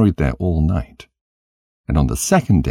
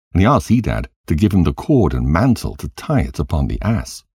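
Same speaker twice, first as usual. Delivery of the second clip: ordinary delivery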